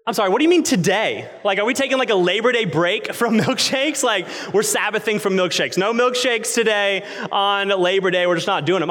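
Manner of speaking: high-pitched, incredulous mock complaint; indignant disbelief. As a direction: question it like you can't believe it. Retold indignant protest at drive-through.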